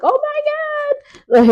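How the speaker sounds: high, slow, stunned oh my God; shocked gasp of the kids. Retold or imitated. Kids watching the fight, a high slow shocked cry. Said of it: "The kids is like".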